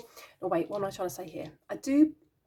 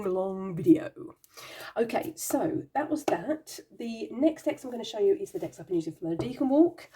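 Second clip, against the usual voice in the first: ordinary talk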